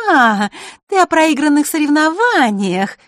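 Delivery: high, honeyed mocking lilt; sweet singsong. A narrator's reading. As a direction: sing it sweetly to needle him. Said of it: "пропела она сладким голосом".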